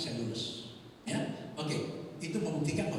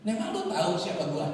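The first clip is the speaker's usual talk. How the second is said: mock threat; 4 semitones higher, 7 dB louder than usual. Con man challenging the speaker.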